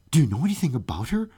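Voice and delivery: rushed, fast and eager question; eagerly. Acted. Student pressing the officer for answers, fast and eager. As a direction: tumble the words out eagerly. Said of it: "said Wolfgang eagerly".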